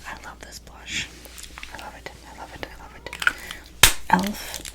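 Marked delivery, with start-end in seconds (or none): 0.1-3.1 ASMR whisper. Real, not acted.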